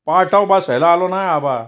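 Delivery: put-on upset old villager.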